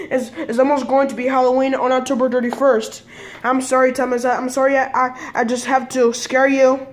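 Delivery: ordinary delivery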